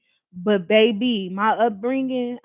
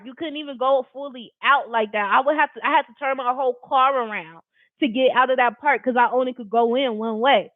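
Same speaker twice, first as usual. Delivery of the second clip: usual speaking voice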